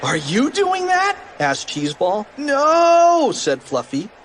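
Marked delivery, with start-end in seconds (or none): 0.0-1.2 put-on very high wondering kid voice. Puzzled question. 2.4-3.3 put-on high, loud kid voice. Puzzled answer.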